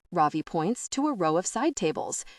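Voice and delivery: usual speaking voice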